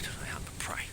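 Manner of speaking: hushed whisper of "They hunt for prey"; conspiratorial whisper about hunting dogs. Shown as a demonstration. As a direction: drop to a secretive whisper on "hunt". Repeats line as a whisper.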